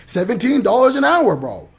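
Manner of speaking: high, loud smug quote of the recruiter; a touch of a smug recruiter bragging; mocking his tone. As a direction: mimic a smug guy bragging about the pay. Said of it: "This is the exact tone he was using".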